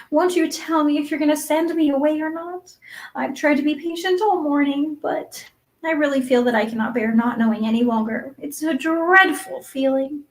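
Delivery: put-on high, pleading girl's voice; an anxious child pleading for an answer; imploring. A narrator's reading. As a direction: beg earnestly, pitched well up. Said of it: "said in an imploring voice".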